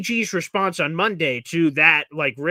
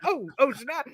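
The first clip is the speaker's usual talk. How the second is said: mock surprise; 7 semitones higher, 4 dB quieter, slower than usual. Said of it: "I was like".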